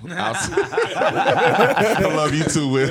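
slow, laughing it out. Real, not acted. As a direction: laugh while saying it. Laughing reply to "I love y'all.

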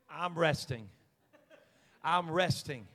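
low, unhurried reply; acted calm. Acts sleeping Jesus against panicking disciples.

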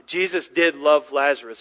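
usual speaking voice

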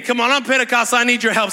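normal talking voice

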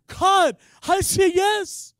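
loud, slow, high declaration; fervent preacher's shout; shouted yes. Real, not acted. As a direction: shout your yes to God. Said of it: "preaching climax".

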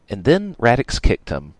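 ordinary delivery, nothing marked

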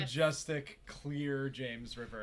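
slow mock praise; dry, sarcastic awe. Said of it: "If you can't tell by our sarcasm".

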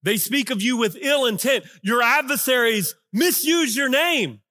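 accusing lament. Acted. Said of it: "he gets to tell God what he really thinks".

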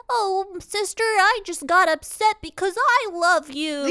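put-on high, sugary kids-show voice; Barney voice. Host comforting his sister in a high sugary voice. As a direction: sing it sweetly like a children's TV host.

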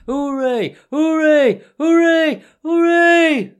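loud, high hoorays; cheering crowd. A narrator's reading. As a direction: whoop it up like a rowdy banquet. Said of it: "they heard, and the stamping of little feet".

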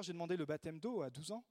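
ordinary delivery

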